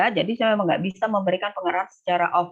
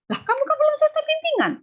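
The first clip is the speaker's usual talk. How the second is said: much higher and faster, incredulous; annoyed supervisor; faster than usual. Retold or imitated. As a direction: voice the lecturer's exasperated retort. Supervisor rebuking pushy student.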